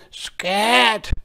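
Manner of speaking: high, loud pig command; sharp storybook pig cry. A narrator's reading. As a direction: shoo it away as an indignant pig. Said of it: "the pig said".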